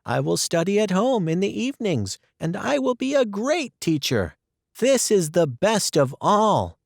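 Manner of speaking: put-on cheerful young girl reassuring; Anne's voice. Anne voiced.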